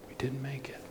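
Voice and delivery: near-whispered retold realization; hushed repeat. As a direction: let it sink in, almost under the breath. Retold thought repeated in dismay.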